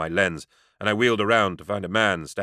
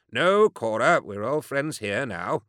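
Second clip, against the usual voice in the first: deadpan; 5 semitones higher, slower than usual. Acted.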